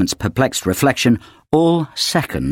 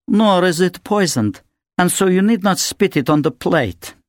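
put-on higher, cold, accented lady's voice; Natasha, haughty; 7 semitones higher than usual. A narrator's reading. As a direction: lecture them with icy disdain. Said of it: "The beautiful Natasha Dolgorova spoke".

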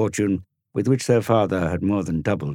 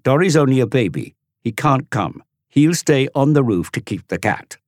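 high, louder mocking boy; scornful big brother; 9 semitones higher, 8 dB louder than usual. Tom to the twins, high loud scornful teasing. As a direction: belittle the little one.

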